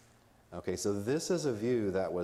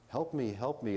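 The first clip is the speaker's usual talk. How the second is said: higher-pitched mock plea for help; lightly acted victim of possession; possessed victim; 5 semitones higher than usual. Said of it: "think of a demon-possessed person".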